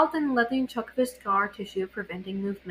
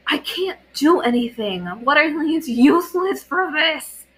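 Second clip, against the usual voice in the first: louder, slowed, frustrated growl; 6 semitones higher, 8 dB louder than usual. A narrator's reading. Katara growling at her own useless healing. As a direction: let it grind out in anger. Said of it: "she growled out".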